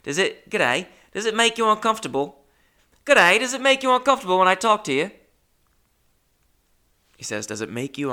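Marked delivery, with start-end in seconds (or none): 0.0-2.4 put-on high, quick retry at an Australian accent; bad Australian accent. Gym acquaintance asks if you're uncomfortable, in a bad Australian accent. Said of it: "I can't do the Australian accent". 3.0-5.1 loud, high, quick cartoon Australian; hammed-up, badly faked Australian accent at a high pitch. Gym acquaintance greets and questions you, in an overblown bad Australian accent. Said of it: "I can't do the Australian accent".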